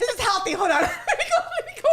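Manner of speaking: high, loud, laughing fluster; mock panic. Girlfriend thrown by a healthy talk, high mock panic.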